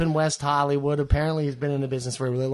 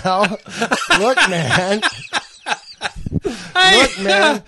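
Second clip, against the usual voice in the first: laughing; 7 semitones higher, 6 dB louder, much slower than usual. Real, not acted. Laughing through the intro.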